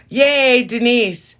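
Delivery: high, loud "yay"; cheering. Real, not acted. Streamer greeting Denise, excited cheer. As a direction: burst out cheering.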